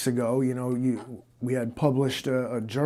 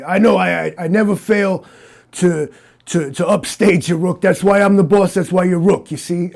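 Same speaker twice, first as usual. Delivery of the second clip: brash big-city detective, pushed louder; tough detective bragging; 4 semitones higher, 8 dB louder than usual. Acted. Interviewer plays Malone bragging to Rook, brash and loud. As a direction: swagger over your sidekick.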